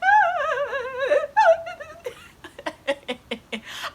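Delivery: long, high wail breaking into laughter; imitated loud bawling; hammed-up sobbing. Student sat down and bawled, mock sobbing. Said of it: "I just broke down crying".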